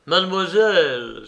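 loud, high call of her name; exclaimed. A narrator's reading. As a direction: cry out to her. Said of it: "s'écria-t-il".

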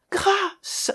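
very high cry for mercy; terrified plea. A narrator's reading. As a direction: beg in a high-pitched cry. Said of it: "s'écria Carfor". (supplication aiguë et terrifiée)

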